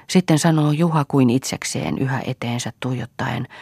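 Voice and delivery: usual speaking voice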